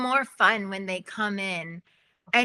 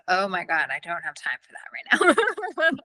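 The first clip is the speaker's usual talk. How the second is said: mock exasperated whisper; 2 semitones lower, 3 dB louder than usual. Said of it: "and I'm like".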